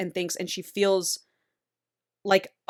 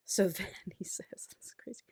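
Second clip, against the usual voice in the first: a hushed, giggly whisper; laughing whisper; 11 dB quieter than usual. Real, not acted. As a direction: drop to a whisper through a laugh. Laughing aside to listener.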